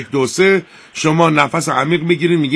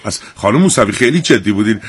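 ordinary delivery

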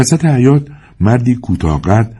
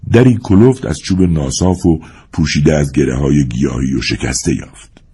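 ordinary delivery, nothing marked

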